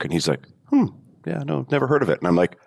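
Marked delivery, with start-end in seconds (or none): 0.7-2.2 high-pitched puzzled bartender voice; clueless bartender. Retold or imitated. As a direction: pitch it up, politely drawing a blank.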